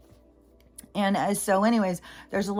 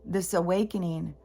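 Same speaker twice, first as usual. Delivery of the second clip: ordinary talk, nothing marked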